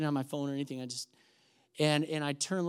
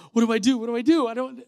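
higher, louder mock panic; flustered; 6 semitones higher, 8 dB louder, faster than usual. Reacting to seeing a church lady at the hotel, flustered. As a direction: blurt it out in a fluster.

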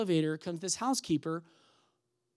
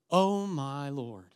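slow, drawn-out astonishment; astonished; 3 semitones lower, much slower than usual. Retold or imitated. Housekeeper running into her old pastor, astonished. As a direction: stretch it out in acted amazement. Housekeeper recognizes him, amazed.

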